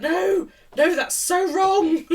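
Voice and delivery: high, loud objection, drawn out at the end; hammed-up dismay tipping into laughter; mock horrified protest. Knitter watching flat-knit cuffs, mock horrified. Retold horror at knitting method.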